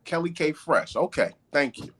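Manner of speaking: usual speaking voice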